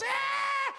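short high shouted roar; team roar. Retold or imitated. Players answering their coach before running out, high shouted roar.

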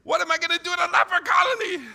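high, fast frightened yell; panicked shout. Retold or imitated. Young missionary protesting the leper colony trip, panicked shout. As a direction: blurt it out in alarm, loud and quick.

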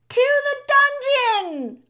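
very high, loud mob yell; crying out. A narrator's reading. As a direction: scream for punishment from the crowd. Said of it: "a voice cried out".

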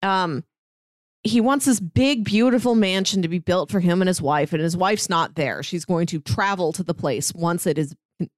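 ordinary delivery

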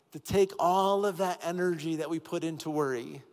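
ordinary talk

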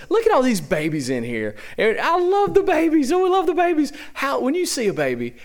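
usual speaking voice

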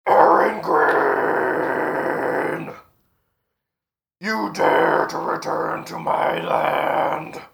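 slow, drawn-out booming dragon voice; booming thunderous voice. A narrator's reading. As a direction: boom it out like a huge beast. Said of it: "a thunderous and booming voice go".

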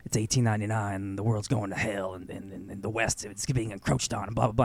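lightly voiced world-weary outlaw; gloomy Arthur. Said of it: "and Arthur's like".

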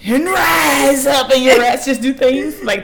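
normal talking voice

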